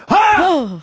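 sudden high yelp; scare yell. Retold or imitated. Acting out the car park scare.

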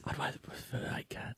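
breathy stage whisper, voiceless. Acted. Co-host whispering a mocking echo, hushed. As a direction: whisper it as a sly aside.

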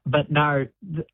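ordinary talk, nothing marked

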